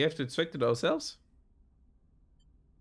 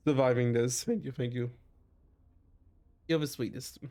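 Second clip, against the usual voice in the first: usual speaking voice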